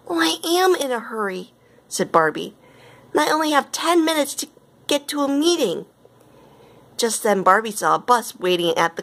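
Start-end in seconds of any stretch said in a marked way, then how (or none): 0.1-5.9 anxious protest. A narrator's reading. Barbie anxious about missing her meeting.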